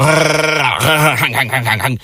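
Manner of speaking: imitated rap gun noises; stuttering parody ad-lib burst; mock rap ad-libs. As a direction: make silly rapper noises with the mouth. Mimicking rapper's gun ad-libs.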